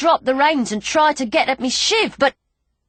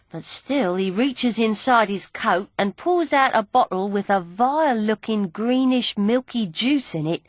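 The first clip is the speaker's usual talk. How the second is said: ordinary delivery, nothing marked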